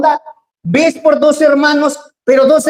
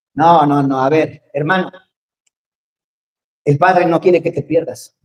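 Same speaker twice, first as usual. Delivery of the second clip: gentle plea; 7 semitones lower than usual. Acted. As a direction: coax the brother back gently. Gentle plea to straying brother.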